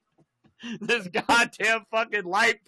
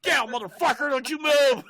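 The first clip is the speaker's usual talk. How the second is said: high mock police command; retold cop yell; 7 semitones higher than usual. Cop pins friend on his belly, mock yelling. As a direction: imitate a cop yelling orders. They were straight screaming.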